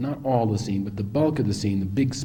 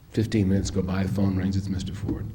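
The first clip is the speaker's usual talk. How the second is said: ordinary talk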